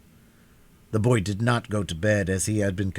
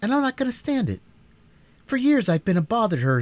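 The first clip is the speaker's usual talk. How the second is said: lightly voiced boy muttering defiance; rebellious; 12 semitones higher, faster than usual. A narrator's reading.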